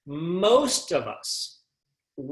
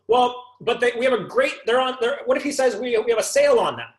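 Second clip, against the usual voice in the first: higher, stumbling salesman voice; flustered salesman; 6 semitones higher than usual. Retold or imitated. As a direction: scramble to save the sale. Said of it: "what if he says to you".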